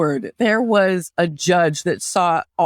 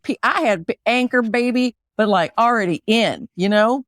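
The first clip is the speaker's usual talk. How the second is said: put-on Southern accent; 2 semitones higher than usual. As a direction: play a needling Southerner with a thick accent. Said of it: "can you do an Appalachian accent".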